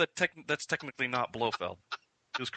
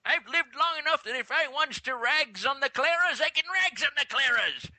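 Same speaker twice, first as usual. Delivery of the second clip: put-on old prospector; 12 semitones higher than usual. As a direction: rant like a grumpy ninety-year-old miner. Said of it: "a ninety-year-old prospector".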